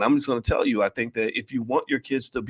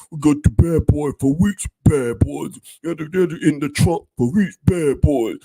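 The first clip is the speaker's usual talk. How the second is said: higher, louder, slow nasal rap voice; 6 semitones higher, 7 dB louder, slower than usual. Retold or imitated. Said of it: "I can't do my [name] imitation".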